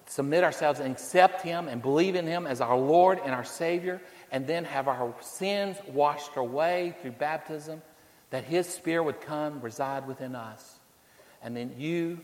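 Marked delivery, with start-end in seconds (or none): none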